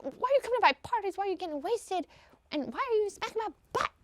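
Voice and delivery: high-pitched mock protest. Tenant protesting landlord's party behavior, high mock whine. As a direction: rattle off complaints in a rising squeal.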